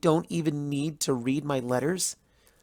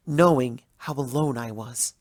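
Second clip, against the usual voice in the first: ordinary delivery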